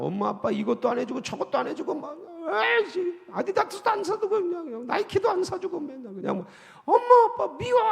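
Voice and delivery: put-on high, whining kid's complaint; whiny child. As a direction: whine like a spoiled child denied sneakers.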